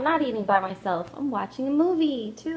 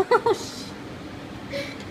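laughing; 14 semitones higher than usual. Real, not acted. Laughing after burning fingers.

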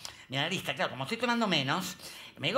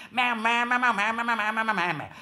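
imitating pastor; 4 semitones higher, 4 dB louder than usual. Imitating Brazilian pastor's babble.

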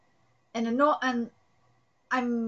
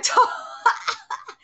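laugh; 10 semitones higher, 6 dB louder than usual. Acted. Reading a character's gleeful line.

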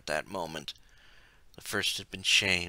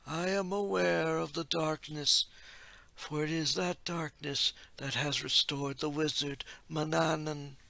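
higher, slow otherworldly oracle; ethereal oracle voice; 7 semitones higher than usual. A narrator's reading. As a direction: intone it like a distant seer. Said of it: "the ethereal voice of the oracle".

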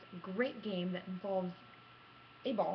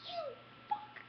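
triumphant cry; 5 dB quieter than usual. Retold or imitated. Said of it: "I'm like".

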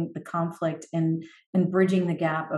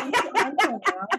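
slow, laughing, higher; 7 semitones higher, slower than usual. Real, not acted. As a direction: say it through a laugh. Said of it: "Until now is like a magic wand".

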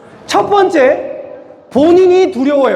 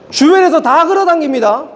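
ordinary delivery

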